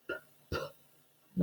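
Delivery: whispered "P-, p-" with lips pursed then a puff; isolated p sound. Shown as a demonstration. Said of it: "our lips kind of, like, pursed and then blow out".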